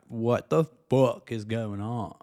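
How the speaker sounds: usual speaking voice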